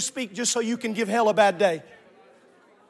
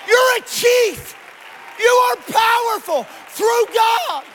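full-force preaching; 10 semitones higher, slower than usual. Real, not acted. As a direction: cry it out to rally the congregation, drawing out key words.

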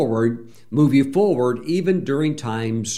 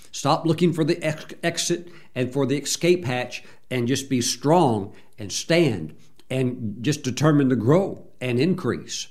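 ordinary talk, nothing marked

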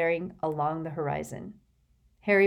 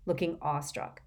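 ordinary delivery, nothing marked

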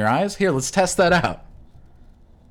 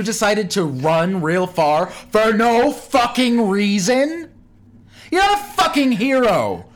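worked-up, high-pitched indignation; angry rant; 6 semitones higher than usual. Acted. Subway rider berating a marathon runner, outraged. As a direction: punch out the insults, building in outrage.

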